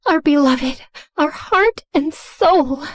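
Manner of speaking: slow, hushed worship of a leader; mock-reverent cult chant; reverent devotion. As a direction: say it like a prayer to an idol. Team worshipping their boss.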